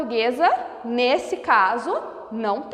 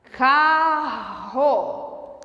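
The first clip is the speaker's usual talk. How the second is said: slow motion. Shown as a demonstration. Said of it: "Pronuncie a palavra carro em câmera lenta".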